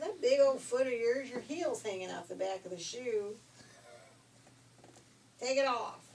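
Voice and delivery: loud scolding. Acted. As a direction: scold her loudly. Messenger scolds sister.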